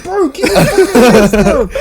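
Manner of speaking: high, laughing mock order; hammed-up paramedic scolding; stern medic. Medics telling the patient to keep his hands down, laughing. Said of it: "the medics are like".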